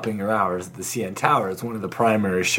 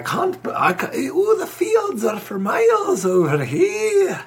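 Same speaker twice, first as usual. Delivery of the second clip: put-on Irish accent; slower than usual. Continuing Irish voice.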